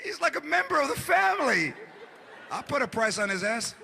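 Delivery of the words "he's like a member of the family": mock tearful outrage; upset mother. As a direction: plead like a heartbroken mom. Mother upset about dog price.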